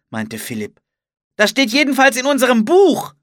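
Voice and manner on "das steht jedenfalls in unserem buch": high, loud insistence; shouted argument. A narrator's reading. Philipp backs his claim with their book, shouting. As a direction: insist at a shout over the danger.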